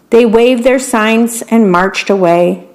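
plain reading voice

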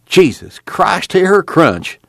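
high, drawn-out exclamation; relishing exclamation. A narrator's reading. Jode biting into tough jackrabbit, exclaiming with relish. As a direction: exclaim over the bite with relish. Exclaiming while tearing at meat.

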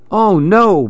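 a sharp wail of alarm; crying out in dismay. A narrator's reading. Billy McGill crying out at the animals' chaos. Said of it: "Billy cried".

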